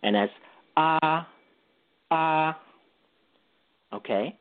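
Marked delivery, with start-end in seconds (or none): 0.8-1.3 "ah" held level and high; first tone. Shown as a demonstration. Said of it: "say the straight tone". 2.1-2.6 "ah" held level and high; first tone. Shown as a demonstration. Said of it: "say the straight tone".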